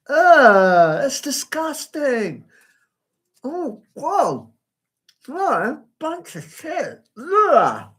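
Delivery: high, loud groans of disgust; revolted at a dog on her path. Real, not acted. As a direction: recoil at the mess on the path. Sees dog fouling her path.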